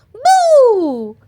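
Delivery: high, loud jump-out boo; ghost boo. A narrator's reading. Peppa as a ghost scaring Granny, a high loud boo. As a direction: leap out and shout it. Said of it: "shouted Peppa".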